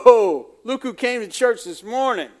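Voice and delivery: loud, impressed gasp of welcome; hammed-up awe at a wealthy newcomer; mock delighted whoa. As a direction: play the fawning usher spotting a rich guest. Greeting the wealthy visitor.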